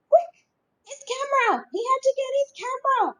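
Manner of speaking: very high, loud, fast rush; frantic excitement. A narrator's reading. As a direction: grab the camera before she goes. Boy rushes for camera.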